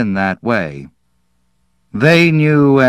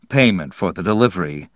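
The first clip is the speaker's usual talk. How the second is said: ordinary delivery, nothing marked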